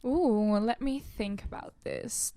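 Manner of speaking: ordinary delivery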